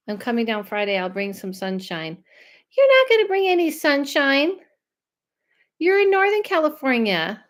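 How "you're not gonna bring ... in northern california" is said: teasing disbelief. Real, not acted. Teasing reply to viewer comment.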